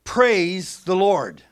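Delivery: slow and loud, "Praise" hit hard as a command; imperative stress. Shown as a demonstration. Preacher showing the understood-subject command, "Praise" stressed. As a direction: command it: "Praise" first and strong. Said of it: "notice, now watch".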